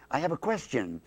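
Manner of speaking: normal talking voice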